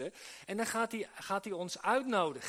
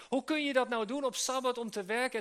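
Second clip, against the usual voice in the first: mock-indignant question, pitched higher; indignant challenge; 5 semitones higher, 4 dB louder than usual. Pharisees challenge Jesus about healing on the Sabbath, indignantly. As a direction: voice the critics' objection, lifting the pitch.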